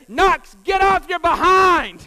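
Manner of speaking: loud, slow yelled order across the field; coach's shout. Retold or imitated. Coach yelling at Knox to get up, loud slow shout. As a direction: bark it like a coach at a tired kid. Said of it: "the coach screamed at him".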